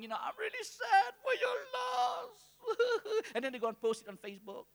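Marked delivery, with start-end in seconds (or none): 0.2-3.2 mock wailing sob, high and drawn out; hammed-up fake weeping of a hypocrite; fake crying. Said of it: "they act as if they're crying".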